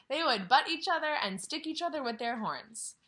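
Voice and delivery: put-on reading a picture book to children; story reading. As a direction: read it out gently to the class. Teacher reads story aloud.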